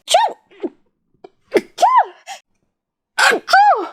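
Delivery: loud high sneeze; real sneeze, high and sharp; sneezing. Real, not acted. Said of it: "Ah, je vais éternuer".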